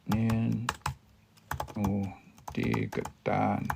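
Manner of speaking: "nino tegetan" drawn out slowly, with long pauses between parts; "nino ... tegetan", spaced out for learners; slow breakdown. Shown as a demonstration. Teacher breaks phrase down slowly.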